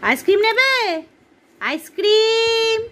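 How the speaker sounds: slow, drawn-out hawker's cry; ice cream seller call. Pretend. Mother playing seller, slow drawn-out vendor call. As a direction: stretch each word like a street vendor calling.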